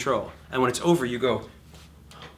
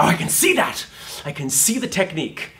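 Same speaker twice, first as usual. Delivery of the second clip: mock enthused; 4 semitones higher, 6 dB louder than usual. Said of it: "it's like".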